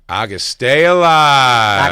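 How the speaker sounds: slow, stretched-out delivery of the line; mock audience line. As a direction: drawl it out like a dazed fan. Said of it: "and you went".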